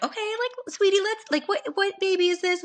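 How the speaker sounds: high, sugary nurse voice talking down; mock sweet nurse; condescending nurse. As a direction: coo at her like a nurse humoring a first-timer.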